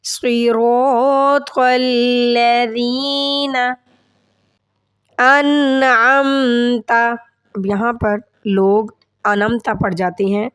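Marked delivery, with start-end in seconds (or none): 0.0-6.9 slow chant, pressing the ya of وإياك; recite, leaning on the shadda; stressed recitation. Shown as a demonstration. Teacher reciting with the stressed ya.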